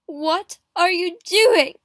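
loud, high, slow outrage; angry question. A narrator's reading. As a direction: demand it, drawing out each word. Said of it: "I asked Darnley angrily".

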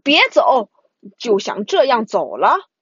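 loud call to stop them; shouting. Acted. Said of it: "怪物喊道".

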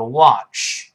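"watch" the American way, wide open mouth; American "watch" with an open ah vowel; American pronunciation. Shown as a demonstration. Teacher contrasting accents for learners, American open "watch". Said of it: "美国人口型大一点".

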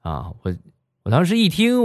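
ordinary delivery

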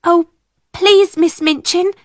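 high, urgent plea; pleading cry. A narrator's reading. Ermengarde begging the angry headmistress, crying out. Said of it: "cried Ermengarde".

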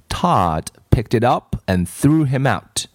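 ordinary delivery

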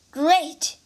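pleased exclaim. Acted. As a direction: say it gladly. Wu Jing pleased.

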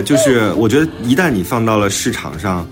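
ordinary talk, nothing marked

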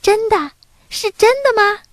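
put-on high-pitched, delighted child's question; happy little boy. A narrator's reading. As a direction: ask like an eager small boy. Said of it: "小男孩开心地问". (小男孩高声开心地问)

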